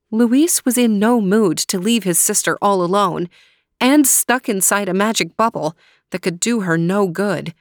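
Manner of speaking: ordinary delivery, nothing marked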